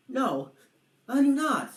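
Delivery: hammed-up frightened dragon; scared refusal. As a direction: refuse out of fear.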